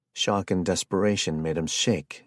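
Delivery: ordinary delivery, nothing marked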